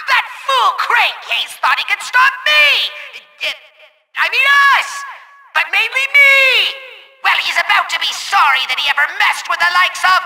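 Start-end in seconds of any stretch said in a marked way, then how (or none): 0.1-6.8 high, boastful villain crowing; triumphant gloating. Acted. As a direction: exult over your foolish rival. Villain gloats over defeated rival.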